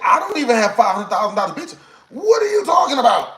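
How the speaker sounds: rising to a high, loud outraged shout; incredulous shouted comeback to the date; outraged yell. Retold or imitated. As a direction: blow up at her in disbelief. Angry retort to a money demand.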